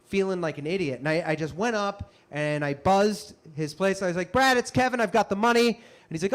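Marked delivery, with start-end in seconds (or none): 4.3-5.7 loud, higher-pitched call into an intercom. Retold or imitated. Actor buzzes the agent to be let in, calling out. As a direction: call up eagerly through the buzzer.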